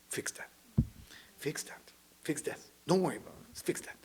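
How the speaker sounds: soft, near-whispered fix that; hushed repeated advice. Retold or imitated. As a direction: murmur it like a quiet master's counsel. Quoting Rav Ashlag's advice.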